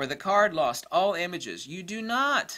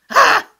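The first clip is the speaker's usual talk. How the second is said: exasperated shriek; 19 dB louder than usual. Real, not acted. Exasperated outburst.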